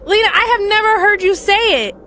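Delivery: loud, high shocked exclaim; real disbelief. Real, not acted. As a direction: say it like you can't believe your ears. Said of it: "So that's shocking".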